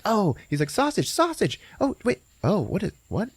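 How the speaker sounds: high, squeaky eager then puzzled; a cartoon sausage delighted, then unsure; hammed-up surprise; mock excitement turning to confusion.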